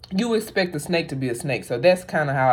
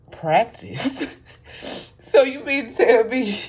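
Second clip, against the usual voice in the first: a higher, stunned echo of practice?; incredulous; 8 semitones higher than usual. Real, not acted. Vlogger echoing Itachi's excuse, incredulous. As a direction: repeat practice? as if you can't believe it. Reacting to absurd killing reason.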